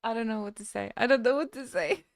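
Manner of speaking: ordinary delivery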